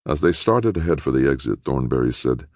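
usual speaking voice

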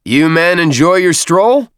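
loud, drawn-out question rising high at the end; loud but friendly. A narrator's reading. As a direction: call it out genially to the troops. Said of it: "in a loud but friendly voice".

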